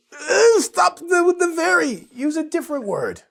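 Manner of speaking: high-pitched mock wail of frustration; exasperated high whine. Teacher begging students to drop very, high exasperated wail. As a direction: cry out in a high, fed-up voice. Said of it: "I just wanna say".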